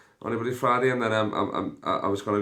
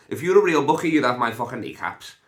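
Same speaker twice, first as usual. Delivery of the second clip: mock threat; 5 semitones higher, 7 dB louder than usual. Mock threatening absent mate Mike.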